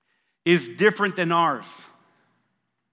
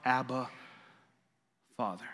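hushed reverent abba; 6 semitones lower, 10 dB quieter than usual. Acted. Reverent quoted cry.

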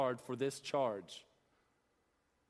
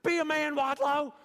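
loud, very high coach impression; high-pitched yelling coach; 13 semitones higher, 9 dB louder than usual. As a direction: holler high, like a coach in your face. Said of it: "high pitched voice".

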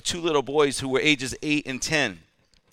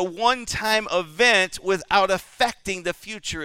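loud, raised preaching voice; preaching at full force; 6 semitones higher, 4 dB louder than usual. Acted. Preacher exhorts the congregation, in loud preaching. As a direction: preach it out with fervour.

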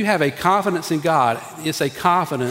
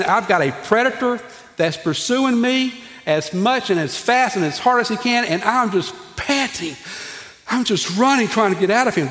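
high-pitched acted plea of someone pursued; hunted man's plea; 6 semitones higher than usual. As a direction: play a man running from a predator. Acts out hunted David's plea.

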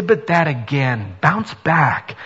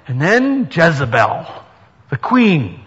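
usual speaking voice

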